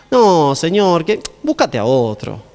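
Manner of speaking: wriggle out of the job, half-heartedly; reluctant Moses. Acted. Moses asking God to pick another, slow reluctant plea. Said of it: "Me lo imagino a Moisés así".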